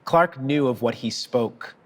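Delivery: ordinary talk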